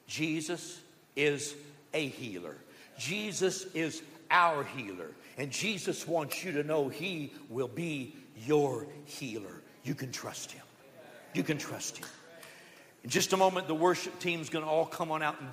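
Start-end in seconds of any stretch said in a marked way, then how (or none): 0.1-12.1 tender assurance. Real, not acted. Pastor comforting the sick in the room, gentle and soft. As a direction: speak gently to the hurting.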